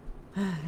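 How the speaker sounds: short audible sigh; reluctant sigh. A narrator's reading. French woman reluctant to tell her tale, sighing. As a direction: sigh before giving in.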